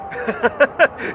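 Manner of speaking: high gleeful laugh. Acted. Balder teasing during game.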